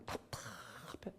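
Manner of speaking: airy unvoiced sigh of frustration; hushed, suffocated complaint; whispered despair. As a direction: breathe it out, stifled. Voicing despair.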